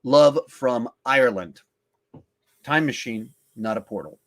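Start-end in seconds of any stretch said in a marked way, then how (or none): none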